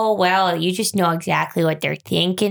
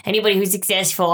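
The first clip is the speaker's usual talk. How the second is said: normal talking voice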